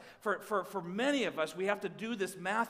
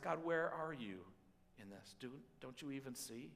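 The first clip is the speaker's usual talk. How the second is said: hushed despairing prayer; 5 semitones lower, 10 dB quieter than usual. Acted. Voiced prayer whispered low.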